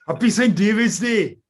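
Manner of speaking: bad Welsh accent, laughing into it; a hammed-up Welsh accent, high. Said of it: "really, really bad Welsh accent".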